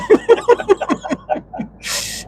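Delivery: a real high-pitched laugh breaking out. Real, not acted. Laughing at his own jail story, a high real laugh.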